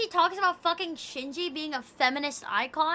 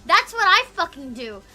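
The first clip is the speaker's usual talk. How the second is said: normal talking voice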